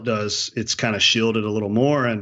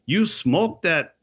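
put-on slow, higher Canadian bush accent; backcountry Canadian accent; 6 semitones higher, much slower than usual. Owner reacts to antelope shot, slow backcountry Canadian accent. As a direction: draw it out in backwoods Canadian. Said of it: "you're doing the accent".

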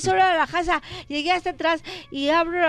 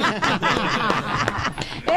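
laugh; 8 semitones lower than usual. Real, not acted. Laughing after punchline.

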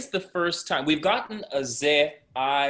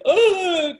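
high, loud pretend sob; mock crying wail; 7 dB louder than usual. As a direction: wail like a hammed-up crybaby. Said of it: "crying, like".

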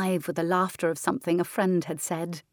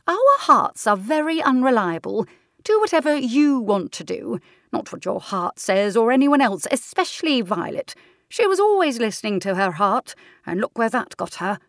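higher, louder no-nonsense aunt; firm aunt; 4 semitones higher, 6 dB louder than usual. Acted. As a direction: lay down the law kindly. Aunt Senna lecturing.

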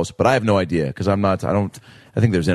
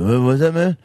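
a hint of a slurring drunk rock singer, higher; 5 semitones higher than usual. Drunk rock star slurring at a teen in a hotel bar, lightly voiced. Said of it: "making him sound like a drunk [name]".

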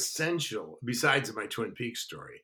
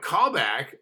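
louder, higher, disbelieving echo of "Call back"; retold incredulity; 5 semitones higher, 10 dB louder than usual. Actor told of a callback, incredulous.

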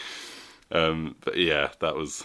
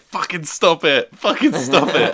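yelled high and loud, bursting out at someone; hammed-up shout of a man leaping from a pile of toilet paper; retold shout; 10 semitones higher, 9 dB louder than usual. Retelling a sketch to a friend, a loud mock yell.

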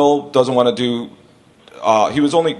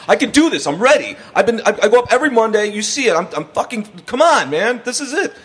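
high-pitched, urgent pleading; begging; 10 semitones higher than usual. Retold or imitated. Young comic begging the club boss for the gig, desperate pleading. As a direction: plead your case desperately, words tumbling out. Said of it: "literally has me begging in his office".

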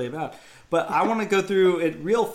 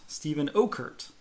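usual speaking voice

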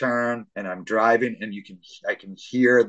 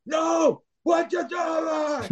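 very high, drawn-out shout; dad yelling in the car pandemonium; disbelieving shout; much slower than usual. Retold or imitated. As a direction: yell it slowly in disbelief.

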